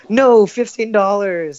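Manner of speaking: high, slow mock whine; mock wail. Stoner mourning his pipe, high and whiny.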